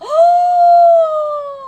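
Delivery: loud, high shocked gasp; a big high-pitched gasp of shock. Real, not acted. Amazed at barefoot story.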